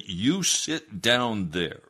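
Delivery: reenacted firm command; stern order. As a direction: press each word as an order. Repeats order, pointing a finger.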